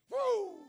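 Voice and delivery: high loud whoop; preacher whoop. Real, not acted.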